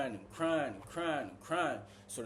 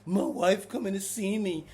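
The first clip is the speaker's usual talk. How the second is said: higher, mock-whiny big guy; hammed-up complaint about missing his visit; whining; 5 semitones higher than usual. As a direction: imitate a grown man whining. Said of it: "He just kept whining".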